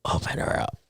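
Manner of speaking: breathy whisper, no voice. Real, not acted. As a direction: whisper it under your breath. Whispered aside while setting up phone.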